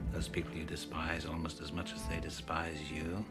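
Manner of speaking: hushed, measured menace; soft taunt. Acted. Villain taunting Clarice about the FBI, soft and hushed. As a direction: speak softly, almost confidingly.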